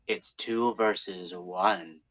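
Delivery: slow, hushed and very low; quiet low statement of the odds; low, ominous. Acted. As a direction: drop right down and draw it out.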